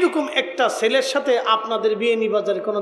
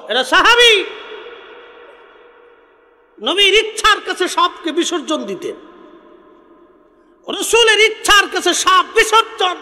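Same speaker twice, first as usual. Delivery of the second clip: high, loud declamation with long pauses; full-force preaching; 10 semitones higher, 7 dB louder than usual. Real, not acted. Preacher extols companions' sacrifice for the Prophet, full-force preaching. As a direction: proclaim it to a big crowd at full voice.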